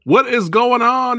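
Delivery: loud, drawn-out show opener; hyped intro shout. Acted. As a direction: belt it like a host. YouTube host opening call.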